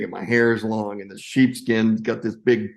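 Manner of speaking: usual speaking voice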